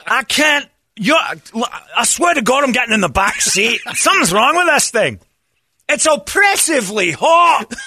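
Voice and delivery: high, loud, slow wail; mock anguished shout. Friend [name] on the heated seat, loud mock wail. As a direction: cry it out like you're burning. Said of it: "[name] panicking in hot seat".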